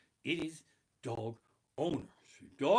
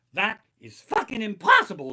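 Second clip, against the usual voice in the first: outraged shout; 5 semitones higher, 10 dB louder than usual. Acted. Ranting comic outburst.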